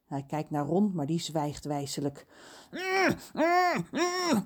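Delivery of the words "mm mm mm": high, muffled humming caw; muffled croak. A narrator's reading. Crow with a glued beak croaks during John's tale. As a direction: crow straining to caw through a shut beak. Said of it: "krast Kraai intussen".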